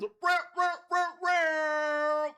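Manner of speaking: chanted real real like a sound effect; mock sound effect. Shown as a demonstration. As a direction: voice the crowd sound yourself. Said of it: "I'll do the sound".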